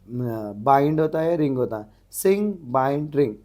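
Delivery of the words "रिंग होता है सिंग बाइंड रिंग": normal talking voice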